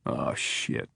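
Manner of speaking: low, soft muttered curse. Acted. Man watching the judge break down, muttered curse. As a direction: mutter it under your breath. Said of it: "Crawford muttered".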